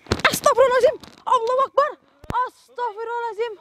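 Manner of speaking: high-pitched startled prayer; startled exclamation. Real, not acted. Reacting to the ghost throwing something at him, startled and high. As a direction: blurt the prayers out in sudden alarm.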